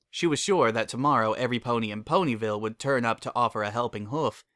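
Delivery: plain reading voice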